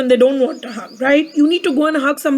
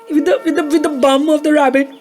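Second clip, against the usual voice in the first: ordinary delivery, nothing marked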